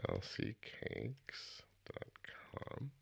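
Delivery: whispered repeat of the web address; hushed, unvoiced echo. Real, not acted. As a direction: breathe the words, no voice. Host repeats website softly.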